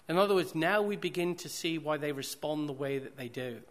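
ordinary delivery

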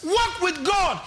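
Walk with God shouted high and loud; shouted preaching. Acted. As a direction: hurl the words Walk with God at the church.

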